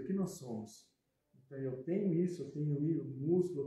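normal talking voice

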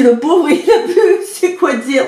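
high voice, giggling as she speaks; mother laughing. Retold or imitated. Mother laughing at the stunned driver, high and giggly. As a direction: act out mom doubled over with laughter. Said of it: "Elle m'a dit".

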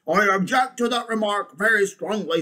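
high, loud bulldog protest; indignant bulldog. Acted. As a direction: object hotly as the bulldog. Bulldog figure objects in story.

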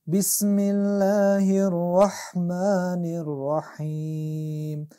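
slow chanted بسم الله الرحمن الرحیم with the ح from mid-throat; slow Quran recitation, throaty ح; tajwid recitation. Shown as a demonstration. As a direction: recite it slowly, pressing the ح. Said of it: "دو جایی که هی آمده از قسمت وسط حلق".

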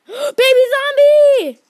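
sudden fright at a monster; startled shout. Real, not acted. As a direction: gasp then a loud, high alarm call. Spots monster, gasps and shouts.

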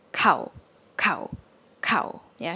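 "cow" kept short and low; short, low Thai "cow". Shown as a demonstration. Teacher drills the short low-tone "cow". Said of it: "This one is".

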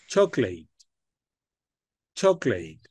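chocolate as \"chóclet\", slow and clear; model English word. Shown as a demonstration. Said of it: "Escúchame primero". (pronunciación modelo de chocolate)

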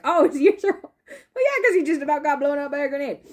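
amused laugh at the obvious. Real, not acted. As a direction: giggle through the realization. Laughing realization.

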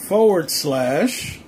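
slow dictation. Real, not acted. Dictating a web address.

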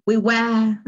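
British "We were", R dropped into a schwa; non-rhotic British "were"; British accent. Shown as a demonstration. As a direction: say "We were" like a Brit, no R. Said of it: "Un británico lo diría".

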